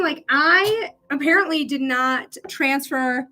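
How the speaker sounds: ordinary delivery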